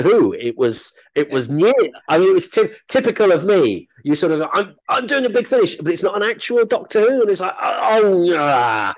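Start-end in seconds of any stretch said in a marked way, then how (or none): 4.5-8.9 high-pitched petulant inner moan; mock grumble, pitched way up; self-mocking whinge. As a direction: whine like a disappointed actor, sending yourself up. Mocks own grumbling at a lesser job.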